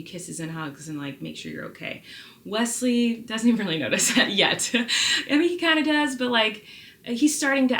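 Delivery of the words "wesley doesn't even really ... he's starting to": laughing. Real, not acted. Laughing about son.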